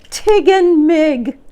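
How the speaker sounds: joyful exclamation. A narrator's reading. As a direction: call out in happy surprise. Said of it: "I exclaimed".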